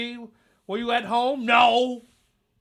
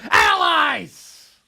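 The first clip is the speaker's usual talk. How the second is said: loud, drawn-out holler; ranting peak; emphatic shout; 7 semitones higher, 10 dB louder than usual. Real, not acted. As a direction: stretch the word out in a yell. Rant peaks on one word.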